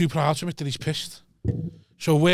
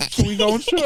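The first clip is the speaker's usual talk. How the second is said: put-on hopeful child; 6 semitones higher than usual. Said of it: "some eight-year-old kid going".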